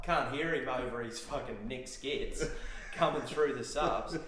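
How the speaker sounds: ordinary talk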